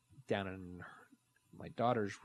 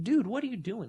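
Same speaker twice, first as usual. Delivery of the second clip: raised, startled dude, what are you doing; exasperated yell; 12 semitones higher, 7 dB louder than usual. Retold or imitated. Dad finding his son on the kitchen counter, yelling in alarm. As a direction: call out to stop him, loud.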